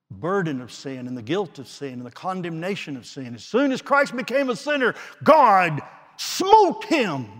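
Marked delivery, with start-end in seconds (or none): 3.3-7.3 building shout. Real, not acted. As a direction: build to a thunderous climax. Climax of sermon on wrath.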